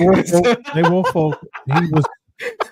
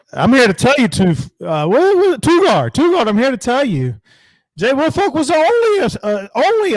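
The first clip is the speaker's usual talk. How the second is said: much higher, louder hype; excited; 9 semitones higher, 4 dB louder than usual. Acted. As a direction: hype it up like an announcer. Hyped dedication to Jay Woolfolk.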